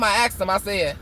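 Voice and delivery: normal talking voice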